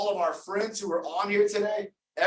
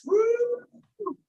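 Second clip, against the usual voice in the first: high whooping cheer; excited cheer. Real, not acted. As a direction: whoop for Chris like a fan. Cheering Chris's message to mom.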